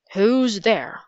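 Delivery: mild narrated shout in a captain's voice. A narrator's reading. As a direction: challenge an intruder, without full volume. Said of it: "the captain shouted".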